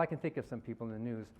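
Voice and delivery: usual speaking voice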